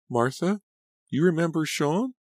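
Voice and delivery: small, high, hesitant question; tiny voice. A narrator's reading. Laura finally answering Martha after a silence, tiny voice. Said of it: "in a tiny voice".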